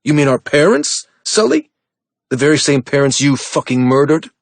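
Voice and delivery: high, loud, mocking sneer. Brother accusing Sully of murdering their parents, sneering loudly. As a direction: taunt him with the accusation. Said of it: "Drake sneered".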